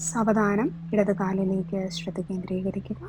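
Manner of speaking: slow yoga nidra voice, drawn-out words and long pauses; guided relaxation. Real, not acted. Yoga teacher talking listeners through relaxing each body part, guided meditation. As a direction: lead the body to let go, unhurried, phrase by phrase.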